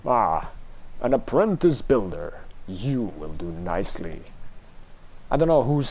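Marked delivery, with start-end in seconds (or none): 0.1-4.2 a low, ominous stranger's voice; mysterious low voice. Acted. Unseen voice greets the apprentice builder, low and ominous. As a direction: speak like a hidden power sizing up the builder.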